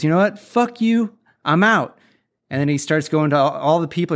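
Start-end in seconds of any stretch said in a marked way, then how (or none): 0.0-2.0 much higher, snapping blow-off; mock angry quitting. Cook quitting on the spot, mock angry. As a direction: fling it out as you quit on the spot. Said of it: "And he goes".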